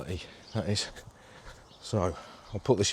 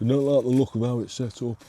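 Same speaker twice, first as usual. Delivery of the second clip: complaining Yorkshire voice, louder and higher; Yorkshire accent; 5 semitones higher, 5 dB louder than usual. Retold or imitated. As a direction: moan like a Yorkshire angler. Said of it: "I always do these Yorkshire accents when I imitate somebody".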